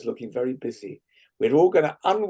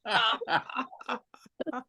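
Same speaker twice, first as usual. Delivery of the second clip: laughing ah; 8 semitones higher, 7 dB quieter than usual. Shown as a demonstration. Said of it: "we'll do that five more times".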